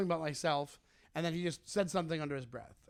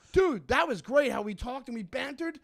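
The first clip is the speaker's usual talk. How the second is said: mock mentor; 7 semitones higher, 4 dB louder than usual. Comic playing an imagined fawning mentor to the heckler. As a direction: ham up a fawning comedian. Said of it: "expecting me to go like this".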